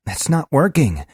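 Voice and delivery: put-on young initiate fed up with the machine; impatient. A narrator's reading. Korash snapping at Globin, impatient. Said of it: "said an impatient Korash".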